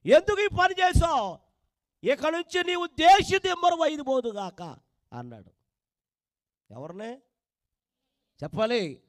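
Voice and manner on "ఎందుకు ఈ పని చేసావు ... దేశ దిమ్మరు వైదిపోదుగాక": loud, raised judgement from God; God pronouncing a curse. Acted. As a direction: thunder the curse down on Cain. God curses Cain in story. (కయీనును శపిస్తున్న దేవుడు, గట్టిగా)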